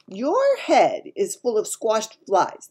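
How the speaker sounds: slow, loud giant insult; scornful giant. A narrator's reading. Giant mocking Sophie's ignorance, slow and loud.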